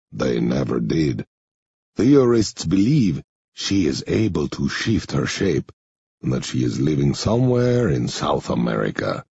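low, measured man's voice; low-pitched, deliberate character voice; Henri character voice. Acted. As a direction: play Henri low and subdued, slightly foreign.

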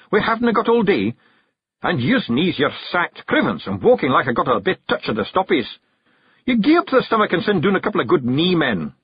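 high Scots grumble; bearded stranger, grumbling. A narrator's reading. Stranger berating his own knees, grumbling high Scots.

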